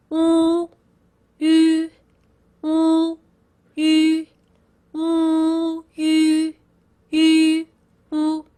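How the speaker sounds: wu and yu held as steady, level vowels; alternating vowel demo. Shown as a demonstration. Teacher showing learners the mouth shape, sustained level vowels. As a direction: alternate back-tongue wu with front-tongue yu, lips unchanged. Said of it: "watch my mouth shape".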